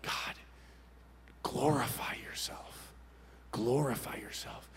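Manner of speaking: hushed, near-whispered plea to God; soft, breath-held petition; fervent prayer. Retold or imitated. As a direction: pray it slowly under your breath. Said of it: "I prayed down with all the faith".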